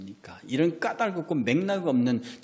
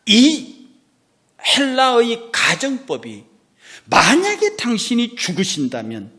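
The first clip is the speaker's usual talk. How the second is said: loud, high, slow preaching; full-force preaching; 10 semitones higher, 9 dB louder, slower than usual. Real, not acted. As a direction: bellow it from the pulpit.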